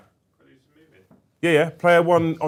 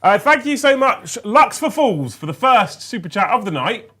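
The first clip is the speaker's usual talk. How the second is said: excited thanks; 7 semitones higher, 5 dB louder than usual. Real, not acted. Thanking super chat donor.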